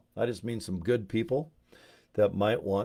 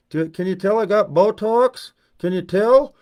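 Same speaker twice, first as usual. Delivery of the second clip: much higher, louder, put-on fishing-for-compliments voice; Botox lady; 10 semitones higher, 9 dB louder than usual. A Utah woman showing off her Botox, high and loud. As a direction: preen and beg for a compliment. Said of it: "they look like this".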